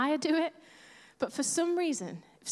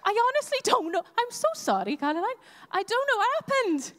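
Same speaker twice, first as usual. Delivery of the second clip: high, singsong mock Welsh lilt; Welsh accent; 8 semitones higher, 3 dB louder, faster than usual. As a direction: slip into an apologetic Welsh accent. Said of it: "it all got a bit Welsh".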